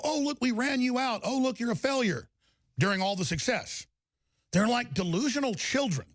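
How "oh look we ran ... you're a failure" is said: lightly mocking higher-pitched taunt; mock gloating. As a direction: imitate opponents crowing, pitched up a bit. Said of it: "that the left go".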